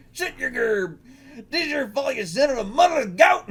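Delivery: high, loud cartoon tirade; hammed-up angry yell. Woman yelling insults at a man, hammed-up angry shout.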